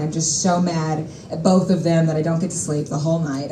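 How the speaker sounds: ordinary delivery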